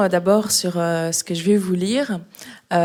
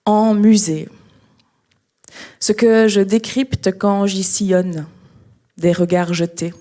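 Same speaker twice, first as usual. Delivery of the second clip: louder, measured recital with pauses between phrases; slam poetry reading; 8 dB louder than usual. Acted. Slam poet reading a museum text to an audience, poetry recital. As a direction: declaim each phrase, leaving space after it.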